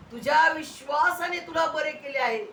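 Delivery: high, ringing declaration; imitated proclamation. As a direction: proclaim it to the healed man. Preacher voices Jesus emphatically. (उंच स्वरात ठाम घोषणा)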